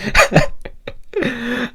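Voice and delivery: short high-pitched giggle; a quick squeaky laugh. Real, not acted. Laughing at own rambling.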